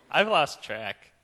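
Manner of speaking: ordinary delivery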